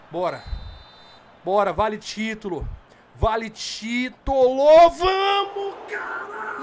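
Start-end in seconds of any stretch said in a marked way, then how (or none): 3.1-6.6 drawn-out, rising celebratory roar; triumphant shout. Real, not acted. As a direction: stretch each word and climb into a yell. Celebrates a save in shootout.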